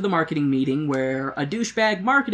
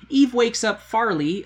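usual speaking voice

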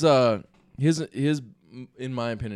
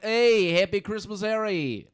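higher, drawn-out cheery greeting impression; mock movie-quote greeting, pitched up; quoted film character; 7 semitones higher, 4 dB louder, slower than usual. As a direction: imitate a film character's loud, slow holiday greeting. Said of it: "because he says, "Happy Christmas".

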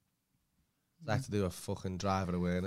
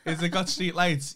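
imitating the instructor; 7 semitones higher, 6 dB louder, slower than usual. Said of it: "They were the only answers".

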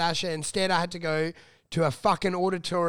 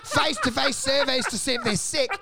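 retold indignant rant; 7 semitones higher than usual. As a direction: throw it back at him in disbelief. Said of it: "And I'm like, I'm like".

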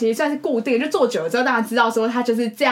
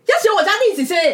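excited realization; 8 semitones higher, 4 dB louder, faster than usual. Retold or imitated. Her sudden understanding retold.

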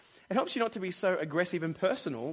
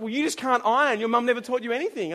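mock insult; 5 semitones higher, 6 dB louder than usual. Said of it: "other person's like".

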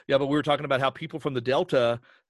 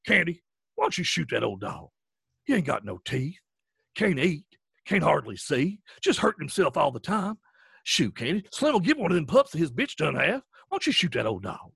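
put-on thick rural accent, words stretched and swooping; Carlson's voice; 3 semitones higher than usual. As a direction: play the old ranch hand nagging a friend, drawling. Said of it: "that voice in my head is".

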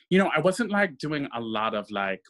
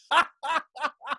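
laugh. Real, not acted. Laugh heard.

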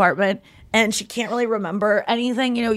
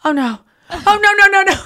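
building to a high, loud panicked no; mock horror, rising fast; panicked Rachel; 10 semitones higher, 6 dB louder than usual. Rachel realizing Ross heard the message, rising panic.

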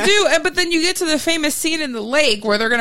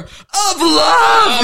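higher, laughing shouted "Of love!"; mock yell; 8 semitones higher, 3 dB louder than usual. Johnny Castle yelling from the back, laughing mock shout. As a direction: call it out from the back of the room. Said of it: "yells from the back of the room".

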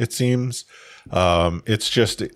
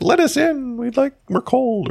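squeaky punchline voice; 13 semitones higher than usual. Retold or imitated. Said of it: "I'm like".

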